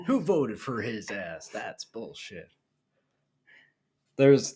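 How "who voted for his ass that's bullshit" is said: slow, fading to a mutter; grumbling mock viewer. Joining the bit, lightly grumbling viewer. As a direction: grumble at the TV, a hint of a disgusted dad. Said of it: "acting out news-yelling viewer".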